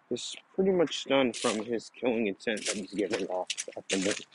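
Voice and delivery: usual speaking voice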